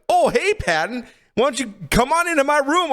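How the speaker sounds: high, lilting mock invitation; inviting big guy. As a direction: play an over-friendly host luring someone in. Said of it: "He's, like, this big jack guy".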